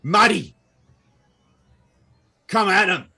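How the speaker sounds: high, loud commanding shout; rally the gnomes to attack; battle orders. Acted.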